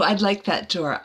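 usual speaking voice

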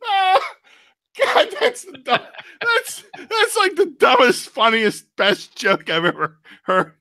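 high, wheezy laugh-crying voice; laughing really hard. Real, not acted. Praising the co-host's dumb joke, breaking up with laughter. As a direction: struggle to speak through real helpless laughter. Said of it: "I was laughing really hard at that".

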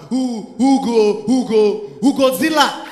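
slow, loud stuck stutter on who; stammering boy. Retold or imitated. Nicodemus stammering loudly to the class, slow stutter. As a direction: drag each who out like you can't get past it. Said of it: "Now Nicodemus like".

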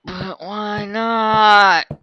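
high, slow pleading; drawn-out plea. Acted. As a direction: stretch it out like a plea. Voiced character, slow and high.

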